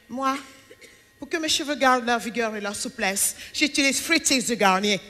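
soft, low breathy-free ad-model voice imitating a commercial; mock shampoo ad. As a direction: purr it like a glossy TV ad. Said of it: "elle te fait". (pub shampoing imitée, douce et grave)

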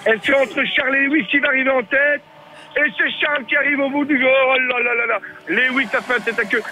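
excited sports commentator calling a toy race; race commentary. Acted. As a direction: call the race as it happens. Live race play-by-play.

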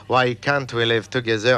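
song title in thick French accent; French-accented English. Real, not acted. Presenter announcing the pop record, proudly French-accented English. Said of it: "avec mon accent merveilleux".

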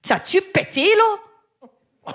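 a quick burst of Quebec French accent, rising on là; Quebec French accent, fast and proud. Shown as a demonstration. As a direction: rattle it off like a Quebecer. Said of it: "ça va aller très vite".

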